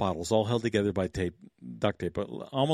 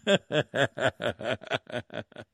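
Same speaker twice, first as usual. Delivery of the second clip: chuckling. Real, not acted. Laughing at the story.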